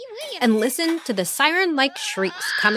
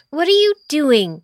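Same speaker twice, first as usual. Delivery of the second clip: loud, higher indignant demand; 6 semitones higher, 5 dB louder than usual. Acted. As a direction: demand it from the ground. Mara glaring up.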